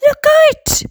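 high, loud warning shout; piercingly high crowd cry; alarmed cry. A narrator's reading. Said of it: "they cried".

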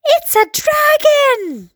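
very high, stretched-out shout; high drawn-out exclamation of alarm; alarmed cry. A narrator's reading. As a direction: cry out at the sight of a monster. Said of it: "they cried".

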